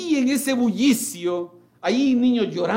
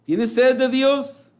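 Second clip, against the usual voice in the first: raised call; 6 semitones higher, 4 dB louder, slower than usual. Acted. Preacher quoting the Lord.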